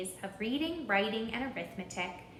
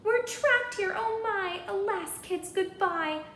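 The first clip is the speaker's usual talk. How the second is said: much higher and louder, hammed-up panic; panicked scream; 10 semitones higher, 6 dB louder than usual. Teacher stranded on the island, screaming in panic. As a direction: shriek it like the end of the world. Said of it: "Miss Lila Greer started to scream".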